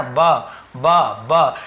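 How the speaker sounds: ब alone, short, with pauses between; bare B sound. Shown as a demonstration. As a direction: repeat ब as a separate base sound. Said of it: "वो तो ब है ना". (ब ध्वनि अलग-अलग)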